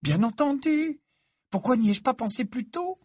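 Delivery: very high, excited exclamation; amazed realisation. A narrator's reading. Hermione wondering why she missed it, amazed. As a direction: cry out as it all clicks, voice soaring.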